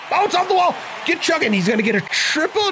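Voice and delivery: excited. Real, not acted. Hit bouncing off wall.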